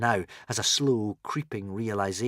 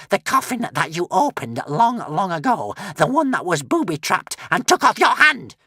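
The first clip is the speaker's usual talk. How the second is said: witch voice; 10 semitones higher, 5 dB louder than usual. A narrator's reading. Witch pressing Alvin.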